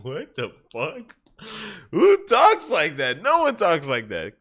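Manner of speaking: high, slow mock disbelief building to loud; baffled outburst. Onlookers reacting to the alien's odd wording, incredulous. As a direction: start stretched out, then blurt it loudly.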